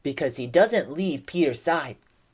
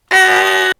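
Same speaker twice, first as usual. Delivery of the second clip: short loud high shriek; acted scream; 13 semitones higher, 11 dB louder than usual. Disciples spotting a ghost on the water, acted scream.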